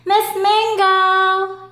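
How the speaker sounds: loud, slow, high sung-out call; yelling. Acted. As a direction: call the teacher in alarm. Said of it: "yelled Alligator".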